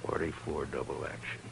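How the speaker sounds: low, very soft; name it quietly and gravely; grave recognition. Acted. Marshal finding the gun in the bundle, low grave voice.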